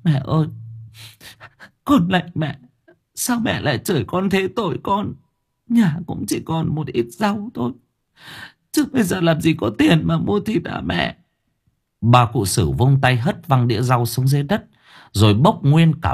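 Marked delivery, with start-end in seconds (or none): 0.0-11.1 high, plaintive crying plea; tearful wailing. A narrator's reading. Mother begging grandmother not to curse her, tearfully. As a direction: plead through tears, pitched high. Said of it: "khóc lóc ỉ ôi".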